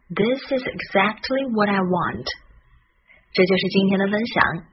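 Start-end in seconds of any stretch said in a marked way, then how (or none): 0.1-2.3 words linked smoothly at natural pace; connected model. Shown as a demonstration. Teacher repeating the key sentence for learners, fluent and connected. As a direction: run the sentence together in one flow.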